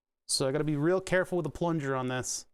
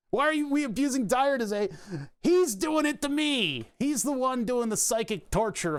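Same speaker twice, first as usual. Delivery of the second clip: mock outrage; 8 semitones higher than usual. Mock complaint at a chatter.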